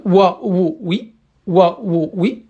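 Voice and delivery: the waw said as a consonant in wa, wo, wi, each syllable short and clear; syllable drill. Shown as a demonstration. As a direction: spell out the waw with each short vowel, one by one. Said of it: "soit une consonne". (épeler wa, wo, wi syllabe par syllabe, bien distinctement)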